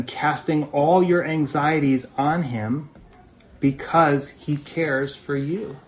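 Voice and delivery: slow, deliberate reading of the verse; slow scripture reading. Shown as a demonstration. Pastor reading the verse to the church, slow and deliberate. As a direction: let each word land slowly.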